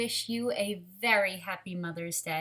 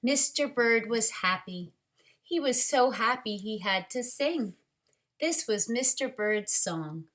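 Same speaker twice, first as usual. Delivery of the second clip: lively, expressive storybook reading, louder than her chat; reading to children; 7 dB louder than usual. Acted. Teacher reading a picture book to children, lively storyteller voice. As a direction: bring the picture book alive for the class.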